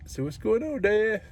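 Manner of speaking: lifted, sing-song voice for the dog. Real, not acted. Talking sweetly to his dog.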